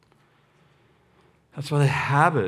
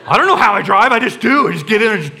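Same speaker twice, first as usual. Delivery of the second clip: loud mock bafflement; 6 semitones higher, 8 dB louder than usual. Impersonating clueless driver.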